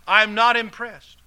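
loud, high, slow mock yell. Preacher re-enacts yelling at show-off drivers, loud slow yell. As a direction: holler it out, drawn out. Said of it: "I used to yell".

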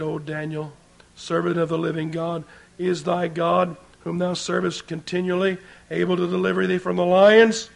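ordinary delivery

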